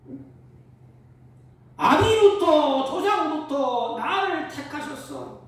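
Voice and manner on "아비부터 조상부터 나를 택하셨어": loud, high, drawn-out proclamation; high, stretched pulpit cry; impassioned preaching. Acted. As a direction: proclaim it slowly at full force. Preaches at full force.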